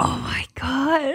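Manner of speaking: breathy whispered "oh my gosh"; hushed stunned whisper; shocked whisper. Real, not acted. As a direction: whisper it in disbelief. Listener reacting in shock.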